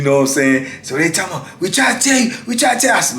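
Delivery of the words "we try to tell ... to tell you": high-pitched imitation of guards; retold I-told-you-so, pitched up; chiding officers. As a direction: scold him in a put-on higher voice. Officers scold him afterwards.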